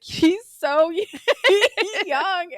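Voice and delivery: high, loud retold squeal; laughing exclamation. Friend back at the table about the man, giggly dismay. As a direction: break up laughing mid-phrase.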